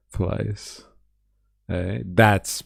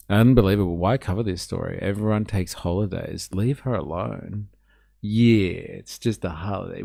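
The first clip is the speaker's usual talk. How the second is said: ordinary delivery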